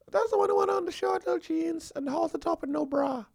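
very high, quick sweet trap question; suspicious girlfriend. Retold or imitated. Girlfriend trapping her man about the cousin, high and sweet. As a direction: bait him in a high sugary voice.

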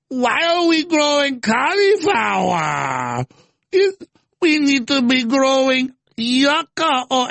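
high, slow, wailing mock cry; whiny mock AOC. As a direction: wail it like a hammed-up crybaby. Mocking AOC on cauliflower.

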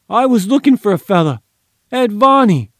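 high, fast, fearful pleading. A narrator's reading. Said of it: "Gimpy replied fearfully".

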